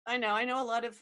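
usual speaking voice

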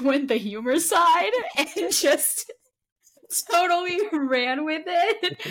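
laughing through the words, drawn out; slow, giggly delivery; amused laughing. Real, not acted. Praising the guest's humor, amused and laughing.

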